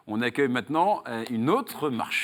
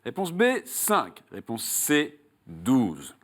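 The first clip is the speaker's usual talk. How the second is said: raised, emphatic game-show host voice, settling on the last option; quiz question read; 6 semitones higher than usual. Acted. Host quizzes a contestant on Saul's road, quiz question read. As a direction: pose the question and lay out each choice.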